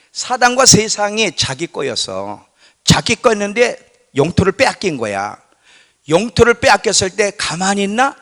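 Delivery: fiery pulpit delivery; full-force preaching. Acted. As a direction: preach it hard to the congregation.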